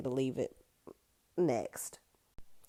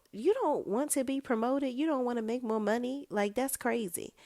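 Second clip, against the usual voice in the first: high-pitched disbelieving questioning; incredulous; 8 semitones higher than usual. Acted. Host challenging an indifferent Danny, incredulous. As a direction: question her in a much higher voice.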